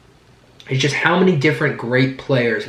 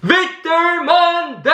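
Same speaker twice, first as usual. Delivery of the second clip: very high, long-held victory cry; full celebratory yell; much slower than usual. Real, not acted. Host leading fans in the Victory Monday yell, drawn-out celebratory yell. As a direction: stretch each word into a soaring, drawn-out yell. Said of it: "yell it with me".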